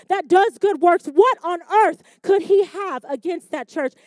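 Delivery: loud, high, rising preaching; full-force preaching. Acted. Preacher asking congregation, loud full-force preaching. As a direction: hammer it at full volume.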